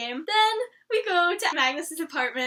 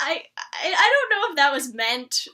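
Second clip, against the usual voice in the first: ordinary delivery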